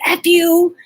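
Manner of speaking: loud, high "F you" flung at him; retold curse. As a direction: spit it out like a trucker. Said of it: "I had that trucker mouth".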